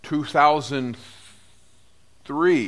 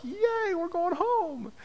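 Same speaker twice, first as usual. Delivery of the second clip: high, soft mock cheer; cheering disciples; 13 semitones higher, 9 dB quieter, slower than usual. Apostles cheering about heading home, high mock cheer. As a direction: squeal it like excited kids going home. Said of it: "all the guys are like".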